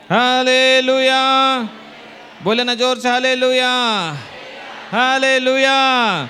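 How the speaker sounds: loud, high, drawn-out acclamation; rallying shout. Real, not acted. Preacher leading congregation in praise to Jesus, rallying shout. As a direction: shout it out long for the whole crowd.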